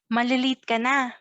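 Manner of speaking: quick, run-together Taglish phrase. Shown as a demonstration. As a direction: say it briskly as one unit. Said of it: "Let's say it faster".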